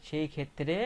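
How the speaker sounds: ordinary delivery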